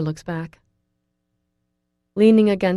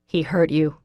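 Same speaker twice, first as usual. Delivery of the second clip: forceful; 4 dB louder than usual. A narrator's reading. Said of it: "in a forceful tone".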